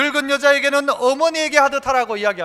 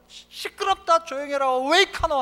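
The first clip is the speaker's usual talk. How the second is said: higher-pitched, exasperated mother's telling-off; mock scolding mother; 3 semitones higher than usual. Mother hushing her cursing son in the street, imitated scolding. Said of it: "아들 등짝을 막 때리면서".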